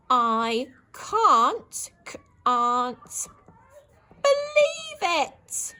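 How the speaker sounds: high, loud wail stretched out slowly; dismayed disbelief. Shown as a demonstration. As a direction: cry out in slow horror. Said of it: "Exclamation mark".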